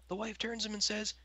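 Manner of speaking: ordinary talk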